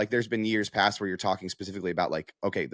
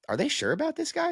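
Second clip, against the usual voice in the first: much higher, disbelieving squeak; incredulous question. Retold or imitated. Retold disbelief at halftime.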